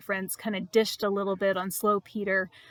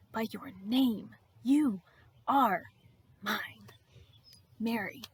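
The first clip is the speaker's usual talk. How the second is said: word by word, long pauses between; tender, solemn claiming; slower than usual. Acted. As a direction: let each word land on a loved one. Jesus claims Mary, word by word.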